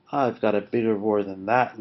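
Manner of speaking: ordinary delivery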